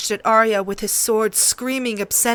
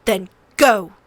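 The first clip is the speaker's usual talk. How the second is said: louder spitting contempt; spat out; 6 dB louder than usual. A narrator's reading. Prince spits contempt at Sansa. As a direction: spit the words with loathing. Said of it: "he spit at her".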